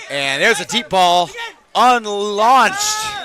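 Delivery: calling the long throw live; excited call. Real, not acted. Play-by-play deep ball call.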